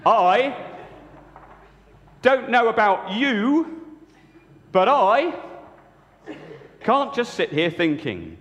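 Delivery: high, slow, stretched-out Peter voice; Peter speaking. Acted. Peter tells the disciples he can't sit thinking. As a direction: draw each word out as eager Peter. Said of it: "said Peter".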